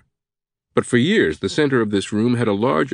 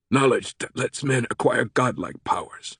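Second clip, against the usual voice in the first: understated sarcasm in a character voice; dryly doubt the grand claim; sarcastic; 3 semitones higher, 4 dB quieter than usual. A narrator's reading. Sato doubting the professor's ancient mysteries, sarcastic. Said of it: "with more than a hint of sarcasm".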